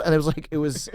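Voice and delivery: ordinary talk, nothing marked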